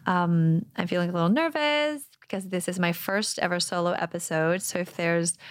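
normal talking voice

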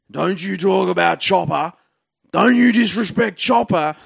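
a rising, hammed-up threat from an aggrieved fan; angry punter. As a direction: growl it like a bloke squaring up for a fight. Angry punter shoving the comic.

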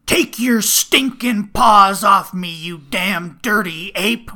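very loud, very slow outraged roar; hammy movie-hero bellow; Charlton Heston. Shown as a demonstration. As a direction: thunder it out, dragging every word. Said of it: "how's your Charlton Heston for the next one?".

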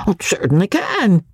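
high-pitched eager assent; hearty, hasty agreement. A narrator's reading. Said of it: "Andy agreed, heartily and hastily".

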